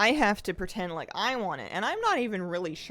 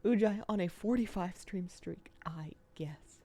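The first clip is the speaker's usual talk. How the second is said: whispering. Real, not acted. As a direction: whisper it under your breath. Unvoiced whisper.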